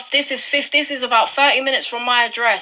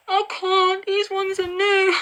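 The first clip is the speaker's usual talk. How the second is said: distressed; 8 semitones higher, slower than usual. Real, not acted. Upset over new discoveries.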